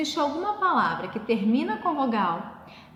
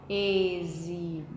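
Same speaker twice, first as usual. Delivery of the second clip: "exibida" slow, low and softer, in separate syllables; "exibida" stretched out syllable after syllable; 6 semitones lower, 5 dB quieter, slower than usual. Shown as a demonstration. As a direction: sound out "exibida" slowly to find the stressed "bi". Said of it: "Sílaba tônica é a sílaba mais forte".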